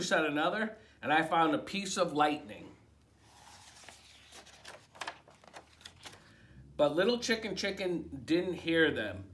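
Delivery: lively picture-book read-aloud; reading to children. A narrator's reading. Librarian reading a picture book to children, animated. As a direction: read it like bedtime story time.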